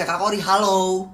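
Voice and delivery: slow, high, friendly greeting; polite greeting. Retold or imitated. How a polite caller should greet Kori, friendly and slow. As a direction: greet her politely and slowly. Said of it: "Mending dia manggil gue ada konteksnya".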